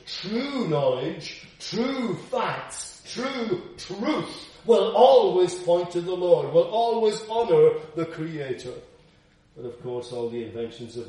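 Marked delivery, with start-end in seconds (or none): 0.1-8.7 loud, high, slow proclamation; fervent sermon climax; preaching at full force. Acted. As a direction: ring it out from the pulpit.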